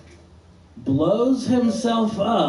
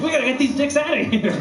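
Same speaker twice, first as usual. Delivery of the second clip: higher-pitched, rapid-fire line; urgent mock command; 8 semitones higher, much faster than usual. Comic acting out a government cover-up, urgent. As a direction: blurt it out fast and high.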